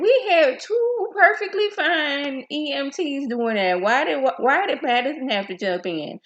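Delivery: why did she jump in, high and loud; exasperated high outburst. Retold or imitated. Vlogger ranting at the TV about Madison, high and loud. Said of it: "I'm like".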